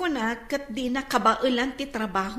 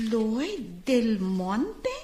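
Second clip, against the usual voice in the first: very slow, low name with rising end; drawn-out questioning; 5 semitones lower, much slower than usual. Acted. Interviewer reading an applicant's name off the bio data, drawn-out questioning.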